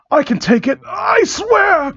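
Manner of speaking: high strained pleading squeal. Acted. Josh pleading with Dennis, a high strained squeal. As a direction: squeak it out, begging. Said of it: "Josh pleaded".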